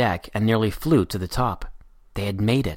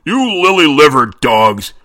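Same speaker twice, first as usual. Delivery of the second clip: much louder, frightening captain's roar; Redbeard's bellow; 4 semitones higher, 10 dB louder, slower than usual. A narrator's reading. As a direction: bellow at lazy sailors. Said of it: "Redbeard's frightening voice bellowed".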